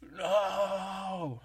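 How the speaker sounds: sudden shrill scream; freaking out. Retold or imitated. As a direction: burst out in a panicked yell.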